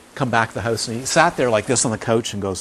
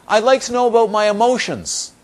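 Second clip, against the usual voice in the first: a much higher, louder student voice asking; student's question; 11 semitones higher, 10 dB louder than usual. Retold or imitated. Teacher imitating a student calling out about emotions. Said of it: "someone said".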